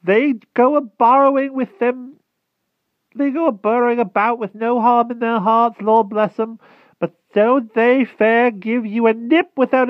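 put-on a frightened old hedgehog begging humbly; timid hedgehog voice. A narrator's reading. Hedgehog begging the Wart for mercy, high timid rustic voice.

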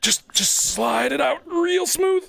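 usual speaking voice

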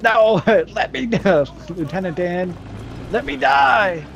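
hammed-up high-pitched begging; mock dramatic plea. Player jokingly begging Lieutenant Dan, mock dramatic plea. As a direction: play at pleading for death, drawn out.

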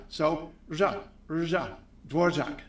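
slow "Dvorak" with Ř as rzh; Dvořák said the Czech way. Shown as a demonstration. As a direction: say "Dvorak" as Dvorzhak, slowly. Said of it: "just say R plus J".

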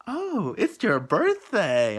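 high, slow, sweet mock lady voice; hammed-up friendly clerk; imitating the lady. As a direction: play the clerk brightly surprised. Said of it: "she's like".

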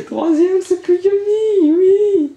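very high, slow, loud cry of joy; squealing with delight; ecstatic squeal. Real, not acted. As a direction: stretch it out, thrilled. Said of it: "vous allez m'entendre jouir".

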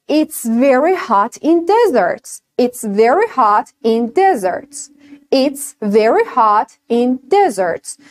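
It's very hot in deserts, spoken very slowly, each word clear; very slow demo. Shown as a demonstration. Teacher reads the example very slowly for students. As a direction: stretch every word for learners. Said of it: "For example".

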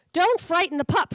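a hint of an old dog's growl; old dog Samson telling Frankie off; mild gruff old dog. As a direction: scold Frankie calmly.

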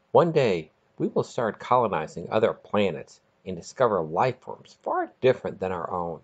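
ordinary delivery, nothing marked

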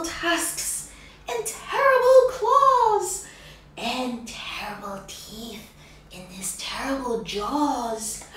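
mouse voice, high then sinking to a whisper; spooky mouse tale. Acted. Mouse scaring the fox, high then hushed. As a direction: spin a scary tale to frighten.

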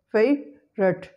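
slow "favorite" in two parts, FAVE, rut; schwa after V deleted. Shown as a demonstration. Teacher drilling the hack, "favorite" slowly split as FAVE-rut. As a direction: split "favorite" with no middle vowel. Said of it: "Schwa sound after V is deleted".

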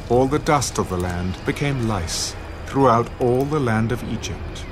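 ordinary talk, nothing marked

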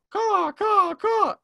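high loud cawing crow call. Shown as a demonstration. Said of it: "Everyone knows caw".

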